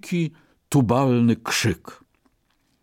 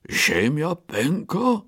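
slow, higher puzzled bear; startled question; 7 semitones higher, slower than usual. Acted. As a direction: ask it, not believing yet. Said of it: "zawołał trzeci niedźwiedź". (zdziwione pytanie niedźwiedzia)

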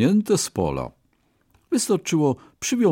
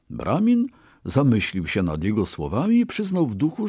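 ordinary delivery, nothing marked

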